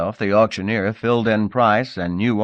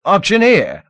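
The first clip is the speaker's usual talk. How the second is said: much higher, louder summons; commanding call; 12 semitones higher, 9 dB louder than usual. Acted. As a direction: call him to heel. Lord calls sharply.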